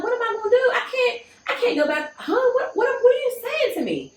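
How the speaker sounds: laughing alarmed what; retold panic. Retold panic, voiced high.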